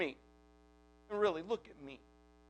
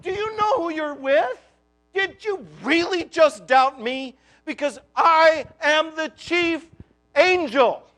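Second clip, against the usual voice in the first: incredulous angel; 4 semitones higher, 7 dB louder than usual. Retold or imitated. Gabriel rebuking doubting Zechariah, loud and incredulous. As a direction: scold him like he can't believe the nerve. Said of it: "can't you just hear the incredulity".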